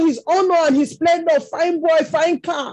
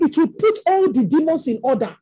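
ordinary talk, nothing marked

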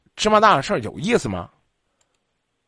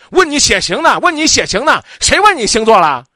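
high, loud furious yell; mock angry boyfriend shout; 9 semitones higher, 7 dB louder than usual. Host plays the caller's angry boyfriend shouting at her, high loud yell. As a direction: bark it like a hot-tempered boyfriend snapping at his girlfriend. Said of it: "你给我温柔点行吗".

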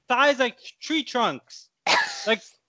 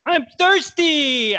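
whiny shout; 6 semitones higher, 6 dB louder, much slower than usual. Acted. Teen begs uncle for lemonade.

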